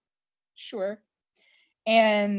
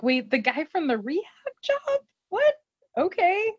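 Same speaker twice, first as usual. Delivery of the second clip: higher-pitched mock disbelief; baffled reaction; 10 semitones higher than usual. Friends on the phone hearing about Hurley, mock-baffled. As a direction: imitate puzzled friends, voice climbing. Crew's baffled reply, retold.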